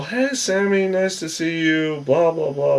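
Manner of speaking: high, sing-song imitation of the girls, lightly done; mock girly greeting. Girls greeting Sammy in the house, mock high sugary voice. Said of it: "They're all".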